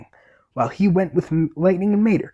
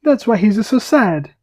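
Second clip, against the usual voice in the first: put-on higher, louder Italian-accented Luigi; 4 semitones higher, 4 dB louder than usual. Luigi realizing his friend Guido is homesick, Italian accent. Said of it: "rate my Italian accent".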